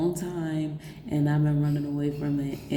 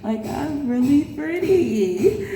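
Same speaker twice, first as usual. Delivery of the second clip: high, drawn-out, laughing mock cry; slow high wail of happy disbelief; retold tearful amazement; 8 semitones higher, 4 dB louder, slower than usual. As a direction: relive being moved to tears, half laughing. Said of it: "I just started crying".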